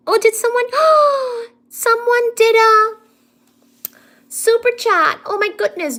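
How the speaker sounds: high-pitched, gasping excitement; thrilled surprise. Real, not acted. Streamer spotting a super chat, thrilled and high-pitched. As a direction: gasp and draw it out high in delight.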